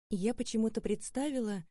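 ordinary delivery

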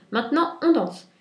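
high, loud cry; exclaiming. A narrator's reading. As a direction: call out to start the dance. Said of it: "s'écria".

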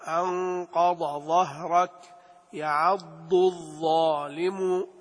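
slow, the ض in أنقض and يعض set apart from the ظ in ظهرك and الظالم; distinct ض and ظ. Shown as a demonstration. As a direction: draw out يعض الظالم, letter by letter. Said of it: "فلا بد من بيانهما". (بيان الضاد والظاء ببطء)

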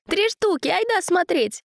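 high eager urging; excited. A narrator's reading. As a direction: pull him along excitedly. Excited boy urging brother.

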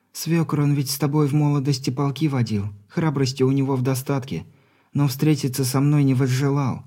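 put-on earnest young granddaughter pleading; young woman voice. Granddaughter speaking.

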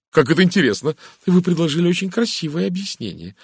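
excitable society chatterer; gushing Mrs Gardner. Acted. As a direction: gush at him with lively swoops. Mrs Gardner admiring Poirot's explanation.

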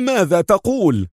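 high-pitched incredulous exclamation; astonished. A narrator's reading. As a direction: cry out in disbelief. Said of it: "بدهشة وهو يهتف".

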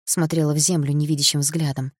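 ordinary delivery, nothing marked

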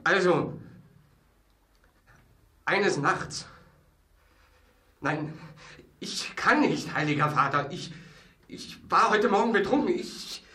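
hoch und laut, in Panik stammelnd; panische Angst. Acted. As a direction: verzweifelt aus Angst vor der Folter betteln. Drohung mit dem spanischen Stiefel. (Angeklagter fleht das Tribunal panisch an)

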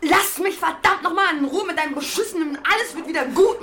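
high, loud furious yelling; furious shouting at her partner; angry shout. Real, not acted. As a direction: scream it at her in rage. Fight, she explodes at partner.

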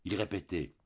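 usual speaking voice

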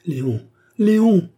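high, loud distraught cry; frantic calling. A narrator's reading. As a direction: call out desperately for rescue, rising on the repeat. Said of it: "appela la jeune fille éperdue". (appel éperdu, aigu et fort)

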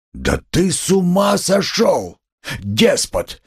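high, drawn-out outraged yell; roaring. A narrator's reading. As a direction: bellow in disbelief, pitch soaring. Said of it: "заревел Разумихин".

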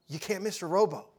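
ordinary delivery

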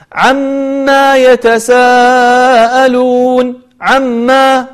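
slow recitation, dragging the end of عما into a long alif; mistaken lengthened vowel. Shown as a demonstration. Teacher imitating a common reciting error, final vowel of عما stretched. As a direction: chant عما with a long aa after the meem. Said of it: "فيمد، فيجعل بعد الميم ألفا ممدودة".

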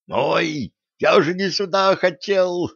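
high desperate squeak; little creature, frantic; squeaky house spirit. Acted. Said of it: "Отчаянный писк домового".

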